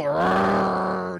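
long rolling growl; imitated angry dog growl. Dog sensing someone is scared, long imitated growl. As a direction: let the growl swell like a riled dog.

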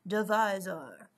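slow mock-French accent. Shown as a demonstration. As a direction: say it like a Frenchman, drawn out. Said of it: "Say divisor like a Frenchman".

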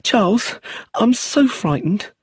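drawn out, clinging to him in fear. A narrator's reading. Brenda begging Charles for comfort, slow frightened plea. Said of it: "Her fear was very real".

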